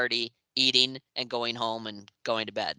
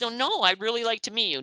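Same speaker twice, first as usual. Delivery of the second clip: imitating Gene, pleading; 10 semitones higher, faster than usual. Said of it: "He said".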